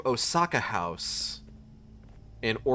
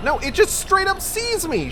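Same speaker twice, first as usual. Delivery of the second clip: much higher, louder shocked disbelief; outraged squeal; 6 dB louder than usual. Real, not acted. Streamer caught by the monster, shrieking much higher. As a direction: shriek it out as you get caught.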